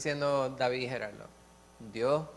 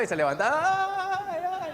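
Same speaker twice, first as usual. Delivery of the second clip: very high, loud "ay" cry, imitating; mock groaning wail; 6 dB louder, slower than usual. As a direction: cry out like the complaining smoker.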